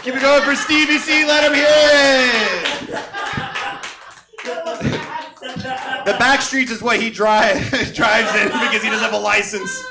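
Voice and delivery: rally the room like a club host; emcee hype. Real, not acted. Host sending off a comic, loud club emcee hype.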